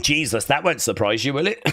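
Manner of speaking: usual speaking voice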